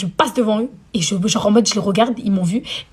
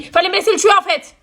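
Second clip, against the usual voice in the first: mock outraged; 9 semitones higher than usual. Real, not acted. Jealous outburst.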